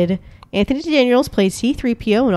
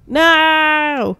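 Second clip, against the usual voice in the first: long, high drawn-out mock cry; imitated melodramatic scream, held long; mock Darth Vader scream; 7 semitones higher, 4 dB louder than usual. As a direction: wail it out like a hammed-up movie villain. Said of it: "Darth Vader screaming".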